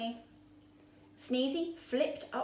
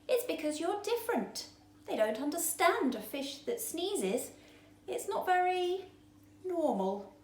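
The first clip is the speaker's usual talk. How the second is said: higher little fish voice; 8 semitones higher than usual. A narrator's reading. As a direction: tell him kindly, small and high. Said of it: "said a little fish".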